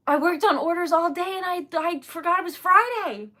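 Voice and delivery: loud, high-pitched flustered excuse. Real, not acted. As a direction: blurt out the excuse in real dismay. Flustered excuse for forgetting Friday.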